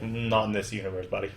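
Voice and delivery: Loki's dry put-down; smug comeback. Retold or imitated. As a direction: brush him off coolly. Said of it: "And Loki's like".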